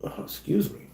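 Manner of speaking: hiccuping, breathy apology; hiccups. Real, not acted. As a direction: caught by a hiccup mid-word. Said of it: "I got the hiccups".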